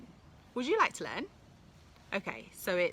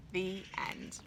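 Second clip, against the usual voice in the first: whispered ending; 6 dB quieter than usual. A narrator's reading. Story closing.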